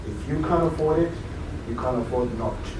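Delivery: a hushed mentor's verdict, fading on not to; quiet mentor. Retold or imitated. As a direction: say it quietly, letting it sink in. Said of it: "he just stared and he said".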